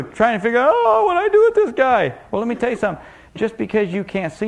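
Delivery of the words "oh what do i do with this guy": high, loud wail of alarm; hammed-up helpless fretting; flustered panic.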